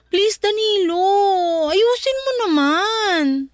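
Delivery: slow, earnest plea to her husband; slow pleading. Acted. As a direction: beg him gently to fix things. Wife pleads with husband to change. (mabagal at taimtim na pakiusap ng asawa)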